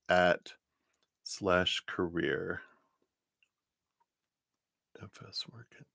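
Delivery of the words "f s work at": whispered to herself. Real, not acted. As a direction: breathe the words without voice. Repeats question under breath.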